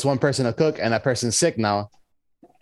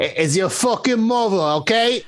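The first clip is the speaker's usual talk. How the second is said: heavy New York accent on "it's your fucking mother", pitched up high; 7 semitones higher than usual. Shown as a demonstration. Friend corrects the movie line, thick New York accent. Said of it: "It's your mother. It's not New Yorker".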